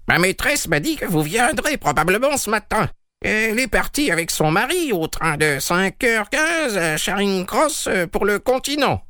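peer down at him with a sly question; sardonic old woman. A narrator's reading. Old servant speaks.

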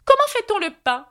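very high quizzing voice; examining Red Queen. A narrator's reading. Red Queen testing Alice on bread, very high demanding voice. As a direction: fire the question from high up.

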